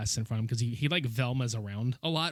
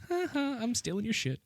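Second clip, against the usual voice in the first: silly clown; 10 semitones higher, 5 dB quieter than usual. Retold or imitated. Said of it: "This is just like".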